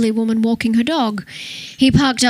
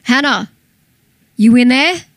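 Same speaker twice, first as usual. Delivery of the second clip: louder falling call, near shouting; calling out loudly; 3 semitones higher, 6 dB louder than usual. A narrator's reading. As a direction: knock and shout to be heard. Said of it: "he said loudly to make himself heard".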